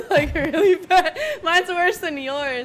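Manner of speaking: loud, high, slow laughing words. Real, not acted. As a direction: talk through real laughter. Friends laughing at bad landing.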